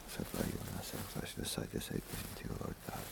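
breathy whispered prayer, almost silent. Real, not acted. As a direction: murmur it privately to God. Priest's silent prayers at the altar.

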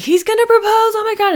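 high, bright gushing squeal of excitement; excited squeal. Retold or imitated. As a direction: squeal it like the big news can't wait. Texting friends, thrilled about proposal.

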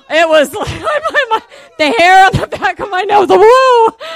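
high, loud, laughing exclamation; excited laughing outburst. Retold or imitated. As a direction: burst out laughing with goosebumps. Thrilled retelling of spooky moment.

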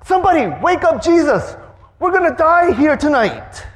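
high, loud frantic cries in the storm; panicked disciples. Acted. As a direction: scream to wake Jesus before the boat sinks. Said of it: "And they're like".

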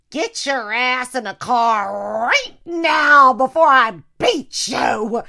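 loud slow parental threat; mom yelling. Retold or imitated. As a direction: bellow it like a furious mother.

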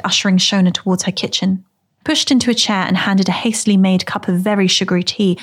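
plain reading voice

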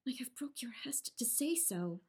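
hushed unvoiced aside; whispered guilty aside. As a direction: whisper the confession to yourself. Guilty aside after naming herself.

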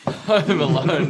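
laughs the title out slow and high; amused laughing repeat. Real, not acted. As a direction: giggle through the words.

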